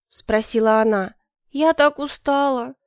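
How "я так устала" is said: put-on high, slow girlish whine; plaintive exhaustion. A narrator's reading. Worn-out favourite begs to be excused, plaintive. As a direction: complain slowly in a high voice.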